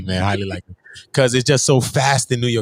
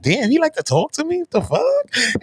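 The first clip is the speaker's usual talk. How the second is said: put-on a thrilled girl who can't believe he called; giddy disbelief; 13 semitones higher than usual. Girl surprised he calls daily.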